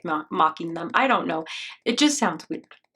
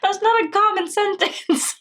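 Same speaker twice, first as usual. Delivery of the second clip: high-pitched, laughing protest; real laughter; 10 semitones higher than usual. Real, not acted. Reactor objects to grandma mishearing fridge as bridge, laughing for real. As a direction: squeeze the words out through laughter.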